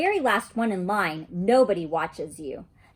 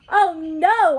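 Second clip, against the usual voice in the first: loud, high dismayed cry; 8 semitones higher, 8 dB louder than usual. Acted. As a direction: blurt it out in alarm, loud and high. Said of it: "I said kinda loud".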